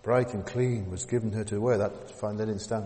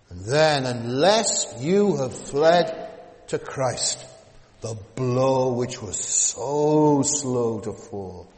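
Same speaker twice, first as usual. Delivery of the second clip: booming warning; 7 dB louder, much slower than usual. Acted. Judgment blow announced.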